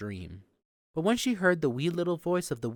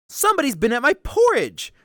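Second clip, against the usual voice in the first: high, loud middle-sized bear; raised, higher-pitched bear voice; 12 semitones higher, 10 dB louder than usual. A narrator's reading. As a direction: cry out indignantly as the middle bear. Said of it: "in his middle-sized voice".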